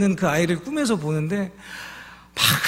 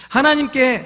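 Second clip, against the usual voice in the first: ordinary talk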